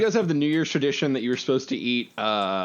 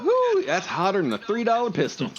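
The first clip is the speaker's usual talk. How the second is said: Southern saying; 12 semitones higher than usual. Pretend. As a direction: crow it like an old Southern farmer. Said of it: "what phrase I always liked is".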